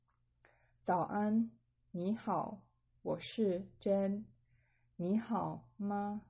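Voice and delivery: slow, careful Mandarin line for learners; slow model reading. Shown as a demonstration. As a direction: spell it out slowly so students follow. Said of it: "I'll repeat it again".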